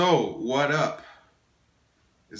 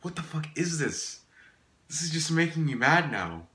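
normal talking voice

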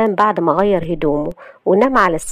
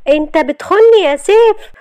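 high, raised-voice outburst; loud accusation; 11 semitones higher, 6 dB louder than usual. Acted. Wife accusing husband of cheating, shouting angrily. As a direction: hurl the accusation at full voice. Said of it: "وقالت له بصوت عالي".

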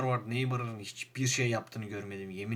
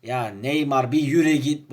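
fed up; 9 dB louder than usual. Real, not acted. Angry at Neymar.

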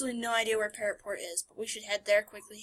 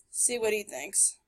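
ordinary delivery

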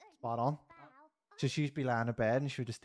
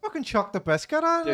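excited shout; 12 semitones higher, 8 dB louder than usual. Real, not acted. Urging attack in game.

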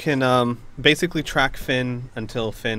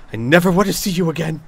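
louder, faster angry dismissal; outraged baroness; 3 semitones higher, 7 dB louder, faster than usual. Acted. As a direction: banish them for good, indignantly. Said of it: "she's wide-eyed and she goes".

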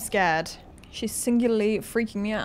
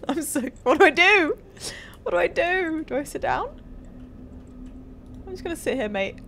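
panicked; 5 semitones higher than usual. Real, not acted. Panicking after scare.

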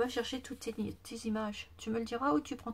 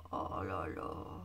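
deep, slow, drawn-out oh là là; low awed sigh; 5 dB quieter, much slower than usual. Real, not acted. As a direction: sink into a low amazed groan. Marvels at the pages. (oh là là grave et traînant)

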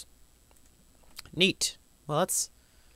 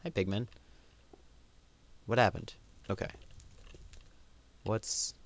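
usual speaking voice

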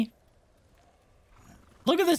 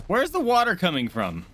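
higher, exasperated question; baffled outburst; 4 semitones higher than usual. Real, not acted. Gamer puzzling over where the water comes from, baffled. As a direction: demand to know, pitch jumping then falling. Asks again, baffled by the game.

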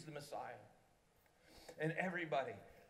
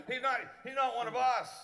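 much higher, louder scornful protest; indignant crowd; 10 semitones higher, 11 dB louder than usual. Retold or imitated. Grumbling townspeople objecting to the tax collector, indignant. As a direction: spit out the rejection.

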